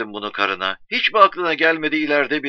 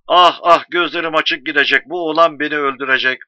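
loud, raised wail of exasperation; lamenting father; 3 semitones higher, 6 dB louder than usual.

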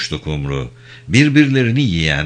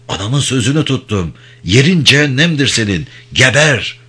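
raised, emphatic pitch building to a curse; vengeful declaration; 5 semitones higher than usual. A narrator's reading. Daughter keeping her mother's word before the final stab, vengeful. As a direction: deliver a death sentence, voice pitched up. Avenger's last words before stabbing.

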